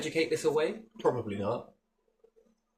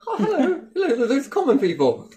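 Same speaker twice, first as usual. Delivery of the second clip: high, loud laughing toff hello; mock posh greeting; 12 semitones higher, 7 dB louder than usual. Lord mocking common people, high laughing hello.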